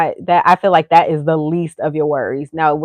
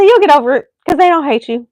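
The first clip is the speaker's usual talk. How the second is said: reassuring a friend; 9 semitones higher, 5 dB louder, faster than usual. Retold or imitated. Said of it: "I said".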